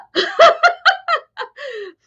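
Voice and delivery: a burst of high laughter. Real, not acted. Laughing at the chaos of her own birth.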